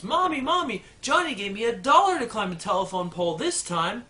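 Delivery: put-on high, eager little girl calling mom; little girl excitedly telling mom. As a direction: play a giddy little girl running in. Little girl runs home.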